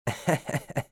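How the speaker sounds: brief sarcastic chuckle; mocking laugh. A narrator's reading. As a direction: snicker in acted derision. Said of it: "بينما يضحك بسخرية".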